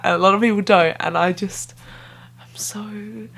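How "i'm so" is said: breathy whispered aside. Real, not acted. Vlogger to viewers, whispering about an inside joke. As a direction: whisper it like a shared secret.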